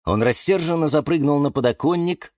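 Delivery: plain reading voice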